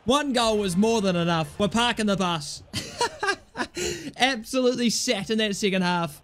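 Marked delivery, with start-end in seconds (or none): 2.7-3.3 short high-pitched giggle; high squeaky laugh. Real, not acted. Gamer laughing at his own bus parking, high giggle. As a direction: let out a quick squeal of laughter.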